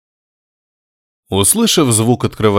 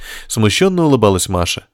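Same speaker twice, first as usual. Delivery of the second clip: ordinary delivery, nothing marked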